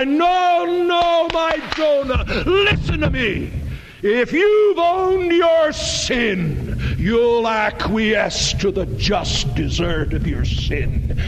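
very high, impassioned rebuke, easing at the end; full-force preaching. Real, not acted. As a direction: cry out the correction, drawing words out.

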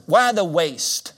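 slow, loud, scornful objection; scornful Judas. Acted. Judas objecting to Mary's anointing, loud and slow. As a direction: sneer at the waste, drawn out.

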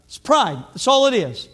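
loud, high, fervent preaching; full-force preaching. Acted. Pastor preaching to his church, loud and fervent. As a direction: preach it out hard to the congregation.

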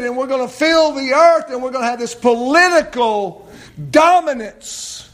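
loud, high, driving sermon; impassioned shouted preaching; full-force preaching. Real, not acted.

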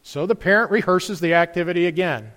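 ordinary talk, nothing marked

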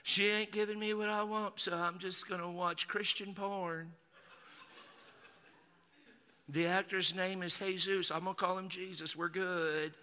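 soft, whiny-husband Southern mockery; mock Southern accent. Husband complaining about his wife, mock Southern accent. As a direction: grumble quietly in a put-on Southern accent. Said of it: "all of my mockery accents are from the South".

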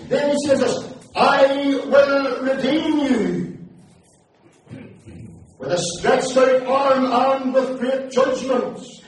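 rising revival-preacher fervour; fiery preaching. Acted. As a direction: preach it out to the whole hall. Preaching scripture at full force.

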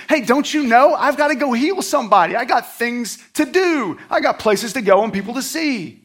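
high, loud, hurried exasperation, acted out; mock-angry impatience at being held up; angry, impatient. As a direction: play someone fed up with being slowed down. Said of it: "I would have been really angry and impatient".

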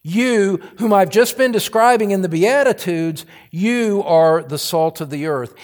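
"You" set apart, high and loud; stress on You. Shown as a demonstration. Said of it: "It's emphatic by the use of the pronoun".

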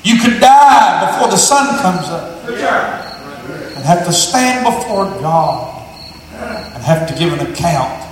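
low, soft, slowing sermon appeal; quiet altar call. Acted. Preacher softly warns listeners they could die tonight, low and quiet.

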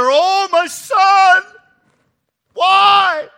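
very high, loud, slow wail; wailing cry. Acted. Preacher voicing David wailing over Absalom, high slow wail. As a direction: let the grief break out in a cry. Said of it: "moaning and wailing together".

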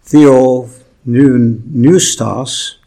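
theopneustos spelled out very slowly; theopneustos, slow and careful; Greek word said slowly. Shown as a demonstration. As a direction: say theopneustos syllable by syllable. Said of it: "the Greek word here is".

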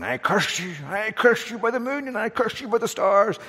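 high, mock witchy curse; hammed-up crone laying a curse. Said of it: "she's going".

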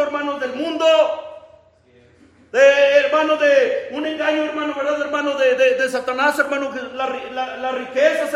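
high, impassioned preacher's voice; high-pitched pulpit exhortation; fervent preaching. Acted. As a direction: preach it urgently, pitched well up. Preaching at full force.

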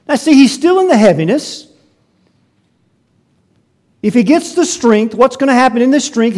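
loud, high, driving sermon delivery; fired-up pulpit voice; full-force preaching. Acted. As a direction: preach it hard to the congregation.

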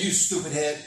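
laughing local "You stupid head"; local saying. Shown as a demonstration. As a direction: say it the local way, playfully. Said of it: "we say it like this".

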